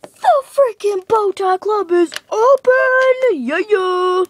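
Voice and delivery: a big cheering shout of news; hyped announcement. Pretend. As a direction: hype it up like a big reveal.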